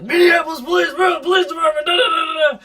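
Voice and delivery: retelling cops shouting their department name; imitated police shout. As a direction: yell it like officers storming the house. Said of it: "and then I heard, like".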